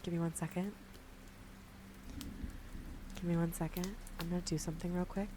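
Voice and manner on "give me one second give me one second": normal talking voice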